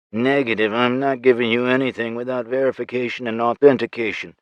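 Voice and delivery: ordinary delivery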